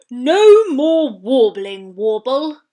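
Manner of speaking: slow, loud booming bellow; bellowing walrus. Acted. Biggest walrus bellows at Warble, slow and loud. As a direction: roar it like a huge bull walrus. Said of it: "fattest one bellowed".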